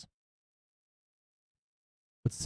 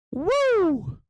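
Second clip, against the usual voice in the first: high, loud whoop of joy; excited whoop; 3 dB louder than usual. Real, not acted. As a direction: cheer the stock on. Cheering the Tesla rally.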